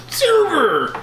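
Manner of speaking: high, drawn-out call from the tunnel; cry from the dark. Pretend. Host heckling from the tunnel, high drawn-out cry. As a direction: call it out high, stretching the word. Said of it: "from the dark recesses of the tunnel".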